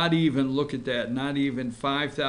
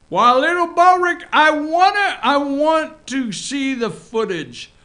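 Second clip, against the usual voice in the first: much higher and louder, a doting Southern fuss; mock doting voice; 13 semitones higher, 10 dB louder than usual. Host teasing Sessions in a high, loud doting voice. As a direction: coo at him like a fond old aunt.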